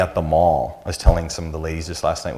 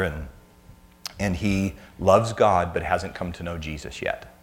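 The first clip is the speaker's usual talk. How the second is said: usual speaking voice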